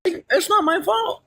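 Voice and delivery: high, slow whine; whiny defense. Acted. Streamer defending herself in a high whine. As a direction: drag it out like a sulking kid.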